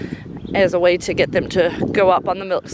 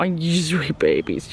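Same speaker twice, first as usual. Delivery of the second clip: slow, lower doting voice; tender coo; 4 semitones lower, 3 dB quieter, slower than usual. Real, not acted. Owner to goats crowding round her, slow tender coo. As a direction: melt into a drawn-out coo.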